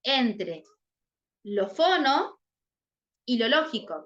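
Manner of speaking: "lo fono" lifted apart after long pauses, then "y lo lógico"; split into parts. Shown as a demonstration. Teacher to the class breaking up "fonológica", slow and pausing. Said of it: "si ustedes se fijan en la palabra".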